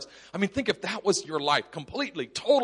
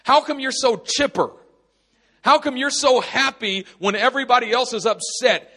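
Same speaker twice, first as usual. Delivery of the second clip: higher, louder amazed questioning; curious people asking; 7 semitones higher, 6 dB louder than usual. Retold or imitated. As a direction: play the puzzled onlooker asking eagerly. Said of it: "people are gonna ask you".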